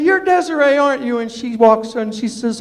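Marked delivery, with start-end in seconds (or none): 0.0-1.2 surprised. Retold or imitated. Greets the tall girl.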